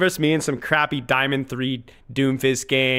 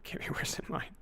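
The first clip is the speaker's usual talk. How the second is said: whisper; 4 semitones higher, 15 dB quieter than usual. Acted. Muttered aside to chat.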